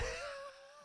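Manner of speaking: high squealing laugh; cracking laugh. Real, not acted. Cracking up at own impression.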